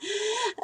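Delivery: short scoffing laugh; rueful laugh. Real, not acted. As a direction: laugh at the absurd claim. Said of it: "sorry, I have to laugh".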